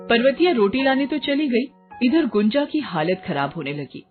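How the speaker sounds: ordinary delivery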